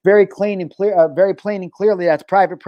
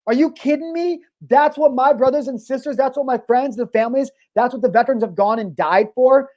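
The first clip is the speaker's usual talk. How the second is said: real indignant outrage, higher pitched; upset rant; 6 semitones higher than usual. Real, not acted. Upset host ranting about what veterans died for, indignant. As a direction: demand answers in disbelief, voice pitched up. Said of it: "What makes me so upset".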